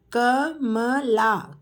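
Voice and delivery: Kamala drawn out slowly; slow teacher model of Kamala. Shown as a demonstration. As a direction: stretch Kamala syllable by syllable. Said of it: "teacher models name slowly".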